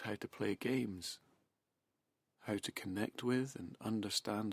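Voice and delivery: hushed guided hypnosis, unhurried and spaced out; hypnotic trance induction. Acted.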